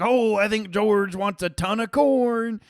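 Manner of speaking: hammed-up cheerful farmer; imitating Bob. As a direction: Bob ordering corn for his goose. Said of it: "he's like".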